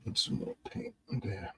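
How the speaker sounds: very soft unvoiced whisper. Real, not acted. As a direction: barely breathe it out. Murmured to self while painting.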